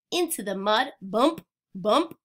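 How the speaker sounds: ordinary delivery, nothing marked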